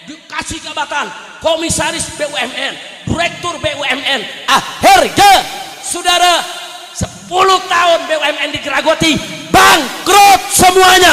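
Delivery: loud, high-pitched fiery oratory; full-force preaching. Real, not acted. Preacher denouncing mismanaged state companies to the crowd, full-force preaching.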